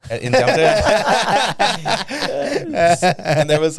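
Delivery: high and loud, slow, through laughter; can barely get it out for laughing; laughing through the words. Real, not acted. Laughing while telling story.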